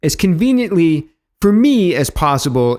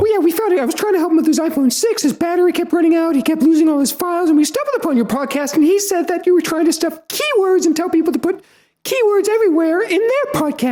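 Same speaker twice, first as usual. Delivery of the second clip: high mock mom voice, fast; imitating mom; 13 semitones higher than usual. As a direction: play a scolding mom, high-pitched. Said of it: "me trying to sound like Mom".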